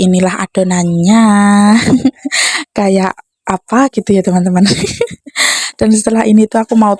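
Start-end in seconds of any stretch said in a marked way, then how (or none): 1.3-2.7 short amused giggle, rising in pitch; a little laugh to herself; small laugh. Real, not acted. Vlogger amused at her own dough, small giggle. 4.7-5.7 quick high giggle, mostly breath; light little laugh to herself; soft giggle. Real, not acted. Vlogger to viewers after a vague remark, soft giggle.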